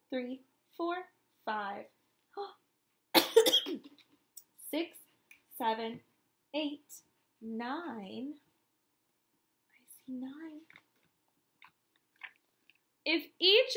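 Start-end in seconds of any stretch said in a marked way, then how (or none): none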